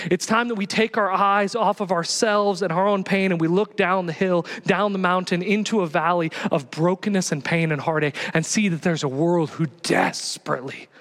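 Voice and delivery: ordinary delivery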